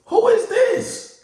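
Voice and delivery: indignant who is this. Retold or imitated. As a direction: stretch it out in disbelief. Angry at stranger in house.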